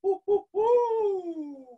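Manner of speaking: high, slow hooting hoo; owl hoot. Shown as a demonstration. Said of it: "Men falsetto".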